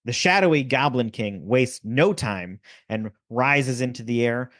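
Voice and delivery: normal talking voice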